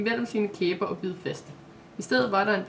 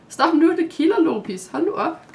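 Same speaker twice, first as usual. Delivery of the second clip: giggling high and loud while being tickled; laughing; 8 semitones higher, 8 dB louder than usual. Acted. Maja to the dog licking her, giggling loudly. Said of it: "grinede hun".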